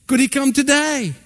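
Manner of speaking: a hint of an eager small girl; lightly voiced little girl. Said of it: "girl asks mother".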